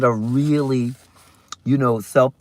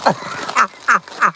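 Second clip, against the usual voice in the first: high giggle; 7 semitones higher than usual. Real, not acted. Laughing at her own song.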